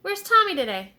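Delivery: high, loud calling out to the dog; calling cow. A narrator's reading. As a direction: call out like the cow. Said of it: "the cow asked the dog".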